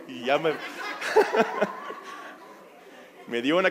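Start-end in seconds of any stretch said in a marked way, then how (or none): 0.6-2.3 airy laugh at himself; soft amused laugh; breathy laugh. Real, not acted. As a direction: laugh off the memory. Laughing at own story.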